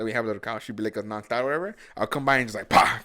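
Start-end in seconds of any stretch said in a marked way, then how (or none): 2.7-3.0 sharp mock bang to startle; loud kick noise. Startling the sleeping dog with a loud pah. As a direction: smack the couch with a loud pah. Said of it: "kick the couch".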